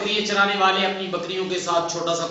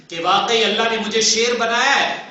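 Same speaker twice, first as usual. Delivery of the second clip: usual speaking voice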